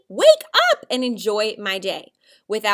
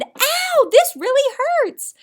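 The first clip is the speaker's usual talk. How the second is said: high, loud pained shout; 8 dB louder, slower than usual. Shown as a demonstration. Teacher contrasting plain ow with a shout. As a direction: yelp it out in pain. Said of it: "I shouted".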